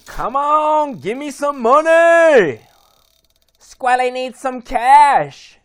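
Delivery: high, loud, begging the wheel; excited pleading. Real, not acted. Pleading for a wheel spin prize.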